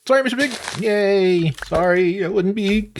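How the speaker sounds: apologising sweetly to the pig; cutesy apology. Acted.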